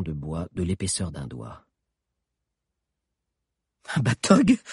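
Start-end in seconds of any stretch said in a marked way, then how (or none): 3.9-4.6 high startled question; surprised, piercing; historian voice. Acted. Historian asks. (question surprise aiguë)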